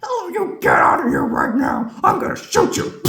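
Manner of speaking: hammed-up furious cowboy shout; cowboy yell. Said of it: "impression of the Looney Tunes characters".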